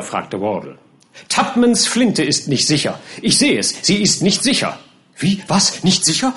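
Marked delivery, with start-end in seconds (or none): 1.3-4.8 high, insistent alarm; alarmed warning. A narrator's reading. Pickwick alarmed by the gun. 5.2-6.3 startled, high rising questions; alarmed. A narrator's reading. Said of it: "im Tone höchster Angst".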